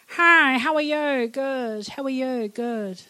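slow, drawn-out greeting that runs on without waiting; mock Kiwi small talk. Shown as a demonstration. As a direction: parody an empty everyday Kiwi greeting exchange. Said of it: "an example of a really deep, um, you know, good quality Kiwi conversation".

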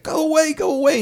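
very high-pitched mock-panicked cry; mock frantic shooing. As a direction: imitate someone frantically shooing a stranger off. Acted panicked wife at window.

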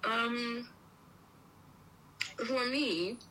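talking while chewing; mouth full. Real, not acted. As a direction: speak through a mouthful of bread. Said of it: "I'm sorry, I'm chewing".

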